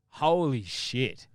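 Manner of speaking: slow, loud, awed holy shit; drawn-out awe at a close call; stunned. Retold or imitated. Storyteller after the elephant leaves, slow loud awe.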